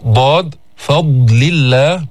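ضاد فضل الله said slowly with a heavy ض; slow careful dad. Shown as a demonstration. Teacher repeats ضاد فضل الله, slowly. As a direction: lean on the ض in ضاد. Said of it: "حرف مستعلي فيه تفخيم".

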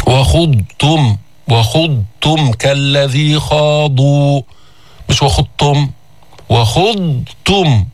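وخضتم slowly, ض held apart from ت; إظهار الضاد في وخضتم; clear dad. Shown as a demonstration. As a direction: stretch وخضتم keeping the ض distinct. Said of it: "وكذا حكم كل ضاد ساكنة".

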